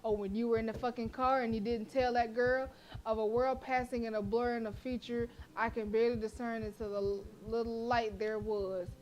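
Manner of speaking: ordinary talk, nothing marked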